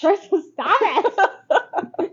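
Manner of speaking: slow, high, loud laughing plea to stop; laughing protest. Real, not acted. Laughing at her friend's crude joke, begging her to stop. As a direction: draw out stop it through laughter.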